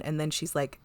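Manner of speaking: normal talking voice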